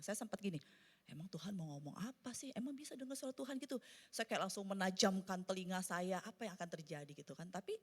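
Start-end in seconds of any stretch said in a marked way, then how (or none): 1.1-3.8 soft, quick doubting aside; retold scepticism. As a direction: toss it off as a private doubt. Retells own sceptical inner question.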